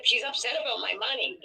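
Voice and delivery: normal talking voice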